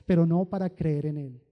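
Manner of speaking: usual speaking voice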